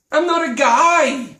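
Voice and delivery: loud, high indignant protest. Acted. Customer objects to being called guy, indignant. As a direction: protest it loudly, drawing it out.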